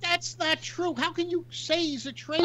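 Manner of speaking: high, stunned protest; incredulous. Real, not acted. Indignant rebuttal to "traitor.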